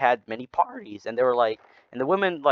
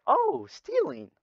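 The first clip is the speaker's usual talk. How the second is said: a higher, slow, longing coo over the sin; mock tempted; 14 semitones higher, slower than usual. Tempted church men mock-craving a sin, cooing and slow. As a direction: savour the idea like a treat.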